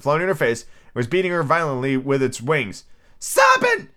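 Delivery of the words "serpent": very high, loud screech; screaming pigeon. Acted. Pigeon accusing Alice, in a high loud scream. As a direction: screech it like an outraged bird. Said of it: "screamed the pigeon".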